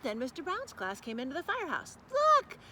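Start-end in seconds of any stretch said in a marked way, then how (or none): 2.1-2.6 much higher, louder excited shout; children shouting. Acted. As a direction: shout like thrilled kids. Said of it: "the children shouted".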